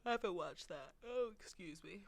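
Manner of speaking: talking through a yawn; words stretched by a yawn. Real, not acted. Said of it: "I keep yawning".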